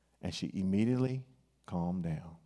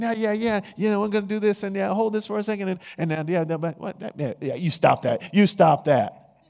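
higher, louder, fast patter into a scolding shout; hammed-up magician distracting the crowd; a magician rattling off distracting patter; fast-talking magician; 9 semitones higher, 10 dB louder than usual. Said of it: "they're working a trick".

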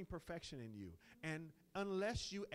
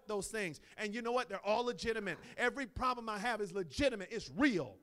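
loud, higher fiery preaching; preaching at full force; 6 semitones higher, 9 dB louder than usual. Acted. Preacher rousing his congregation, full-force preaching. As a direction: drive it home to the church.

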